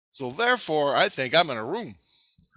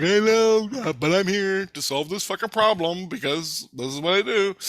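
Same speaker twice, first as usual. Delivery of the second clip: raised, high-pitched insistent retort; defiant retort; 7 semitones higher, 3 dB louder than usual. Retold or imitated. Answering Jesus on why he came down, loud defiant retort. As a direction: act out protesting back, voice pushed up high. Acting his defiant reply to scolding.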